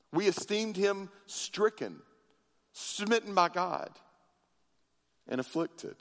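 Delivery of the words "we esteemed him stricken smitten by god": normal talking voice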